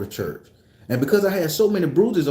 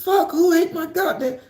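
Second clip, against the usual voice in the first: high mock mother's yell, much higher; mama screaming. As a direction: shriek it like an angry mom. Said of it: "my mama scream".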